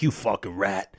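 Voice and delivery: a low, gruff dad sneering at him; imitating his father. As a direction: growl it like a disgusted father calling him a rat. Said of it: "my father's like".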